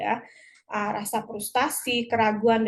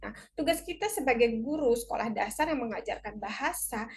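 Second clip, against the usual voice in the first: ordinary talk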